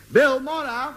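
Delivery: high call to friends out of sight. Acted. Host summoning friends to meet a guest, high call. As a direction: sing the names out high.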